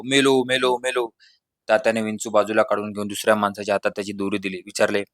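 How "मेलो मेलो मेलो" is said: understated narrated wail, fading away; mock wailing. A narrator's reading. Stung boy cries he is dying, mock wail. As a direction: wail it lightly, storyteller style. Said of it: "मोठमोठ्याने किंकाळ्या पडू लागलं".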